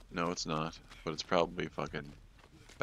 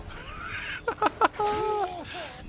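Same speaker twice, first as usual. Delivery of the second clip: squealing laugh; 4 dB louder than usual. Real, not acted. Relief after escape.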